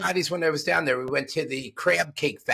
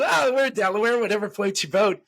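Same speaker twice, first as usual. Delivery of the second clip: higher, carefree Biff voice; easygoing Biff; 7 semitones higher than usual. Retold or imitated. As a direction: shrug it off, pitched up. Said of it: "And he goes".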